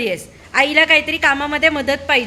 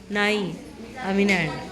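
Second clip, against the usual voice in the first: put-on low, soft, sulky kid refusing; mock refusing child; 7 semitones lower, 9 dB quieter than usual. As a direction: mutter the refusal like a stubborn child. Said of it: "तर ते सांगतात". (हट्टी मुलासारखं नकार देत, खालच्या हळू आवाजात)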